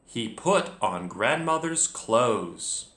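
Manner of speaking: ordinary talk, nothing marked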